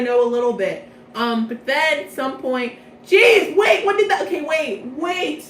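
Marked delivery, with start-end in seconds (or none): 3.1-5.4 startled realization. Real, not acted. Sudden realization mid-recap.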